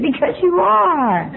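high, slow, drawn-out comeback; emphatic retort. Retold or imitated. Sponsor answering the complaining newcomer, high drawn-out retort. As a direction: stretch it out as if stating the obvious.

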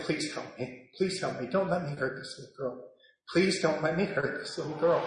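very soft, hushed plea; quiet, low reenacted begging; pleading prayer. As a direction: pray it under your breath. Desperate prayer to God.

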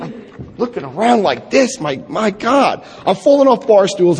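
ordinary talk, nothing marked

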